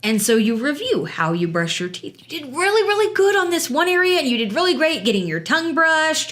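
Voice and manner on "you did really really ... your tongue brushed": put-on higher-pitched praise, as to a child; upbeat demonstration of positive feedback; encouraging praise. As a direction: play a parent building a kid up. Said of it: "Two praises for every one redirect".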